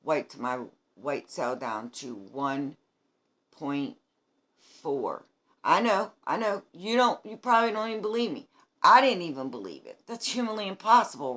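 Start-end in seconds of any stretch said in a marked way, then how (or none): 5.6-11.4 higher, louder and faster, insisting; half-laughing "I know, I know" in amazed disbelief; excited disbelief. Real, not acted. Insisting her count was real.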